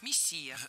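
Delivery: мессия stressed as мес-СИ-я; stress on second syllable. Shown as a demonstration. Teacher sums up the rule, second-syllable stress. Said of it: "ударение в этом слове падает на второй слог".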